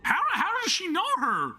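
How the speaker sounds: high-pitched, stammered question; shocked disbelief. Real, not acted. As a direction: squeal it out, utterly baffled. Shocked that characters know each other.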